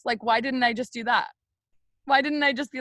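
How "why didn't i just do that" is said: normal talking voice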